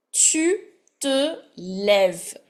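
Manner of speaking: ordinary talk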